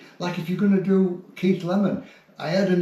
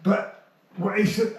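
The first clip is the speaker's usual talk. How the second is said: ordinary talk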